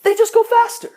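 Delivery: outraged punchline. Real, not acted. Comic on drivers speeding at yellow lights, loud. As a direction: blurt it high and loud.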